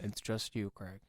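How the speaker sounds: low, soft disembodied voice; voice of consciousness. Pretend. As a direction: murmur low as his inner voice. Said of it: "it's the voice of your consciousness".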